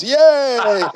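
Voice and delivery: high, loud happy yay; a real, bright burst of glee; excited cheer. Real, not acted. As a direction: cheer it out with delight. Cheering on the group call.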